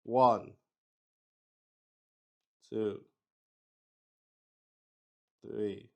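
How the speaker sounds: ordinary talk, nothing marked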